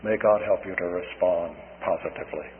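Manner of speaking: low, soft, earnest wish; solemn blessing. Real, not acted. Preacher closing his appeal to the listeners, low and solemn.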